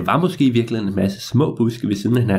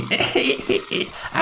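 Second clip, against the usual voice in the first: high, wheezy villain's snicker; gleeful evil chuckle; old man's cackle; 11 semitones higher, 4 dB quieter than usual. Acted. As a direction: cackle in triumph as the old man. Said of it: "høre den gamle mand grine".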